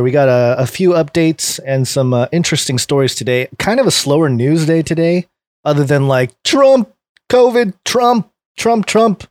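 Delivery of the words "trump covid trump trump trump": exasperated. Acted. Mock exasperated Trump chant.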